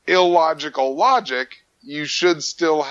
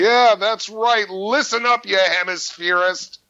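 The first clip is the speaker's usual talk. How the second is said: higher, hammed-up snarl of indignation; mock angry; 8 semitones higher than usual. Teasing the Southern Hemisphere co-host with mock anger. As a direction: puff up in playful fury. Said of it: "while you're getting really angry".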